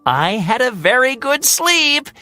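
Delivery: high, cocky little bird voice; boastful Hummingbird. Acted. Hummingbird boasting to Heron, high and cocky. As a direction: brag about the lead.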